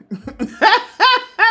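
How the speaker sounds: sudden high laughing burst. Real, not acted. Laughing to viewers about liking glue. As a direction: crack up at yourself.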